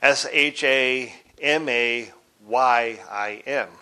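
slow, letter by letter; spelled out. Shown as a demonstration. Teacher spelling the other Hebrew form, slowly letter by letter. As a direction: spell it out with the letters held apart. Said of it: "which is spelled".

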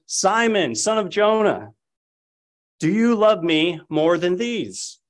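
a lightly voiced Christ pleading with his friend; voicing Christ. A narrator's reading. Christ questions Peter, read aloud.

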